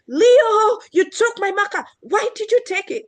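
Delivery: high, loud accusing snap; hammed-up angry kid confronting a friend; angry accusation. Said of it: "instead, you know, she could have just said".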